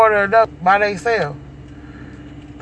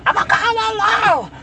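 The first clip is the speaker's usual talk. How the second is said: mock threat; 3 dB louder than usual. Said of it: "that hoe went off".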